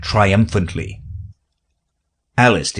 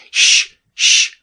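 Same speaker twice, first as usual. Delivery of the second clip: sharp whispered shh; shushing; 3 dB louder than usual. Acted. Hatter and March Hare hush Alice, whispered shh. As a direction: hush someone firmly. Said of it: "the Hatter and the March Hare went".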